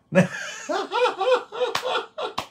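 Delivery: long, high-pitched burst of laughter; real laughing fit. Real, not acted. Host amused at giving Ate Marie a number, high laughing fit.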